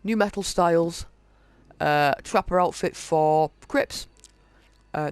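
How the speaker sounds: usual speaking voice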